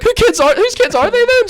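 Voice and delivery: very high, loud acted protest; exasperated comeback. Teller challenges woman over the kids, exasperated high retort. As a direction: fire back in squealed exasperation.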